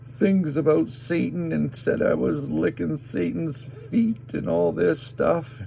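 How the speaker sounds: ordinary talk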